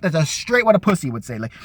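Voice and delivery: normal talking voice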